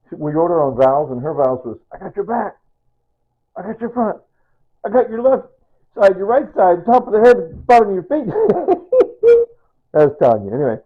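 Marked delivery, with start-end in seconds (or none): 1.9-8.3 high, chanting list, building louder; high, mounting playful vow; recited wedding vow. Retold or imitated. Recites his wife's wedding vows. 8.3-9.5 high, loud giggle; high-pitched cackling chuckle; delighted laugh. Real, not acted. Laughs after reciting her vows.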